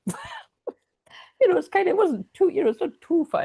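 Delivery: high squeaky giggling talk, laughing through the words. Real, not acted. Laughing, embarrassed about drinking on beach.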